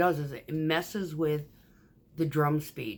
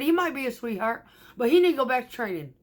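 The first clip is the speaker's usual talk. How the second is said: emphatic retort; 8 semitones higher, 5 dB louder, faster than usual. Retold or imitated. Retold answer to coworker.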